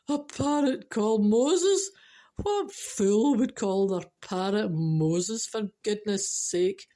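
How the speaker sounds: slow, loud opening, drawn-out scoff; mocking disbelief. Burglar laughing at a parrot called Moses, mocking disbelief. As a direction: scoff at it, stretching every word. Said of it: "the burglar laughed and he said".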